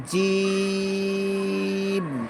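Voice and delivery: জিম with a hard j and long held ee; firm, drawn-out jim. Shown as a demonstration. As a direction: hold জিম on a level note, closing on m. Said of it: "জিম শক্ত". (জিম শক্ত ও লম্বা)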